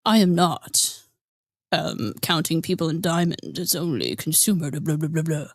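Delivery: lightly mocking a smug excuse-maker; Edwin voice. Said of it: "I'm gonna try to do the Edwin voice".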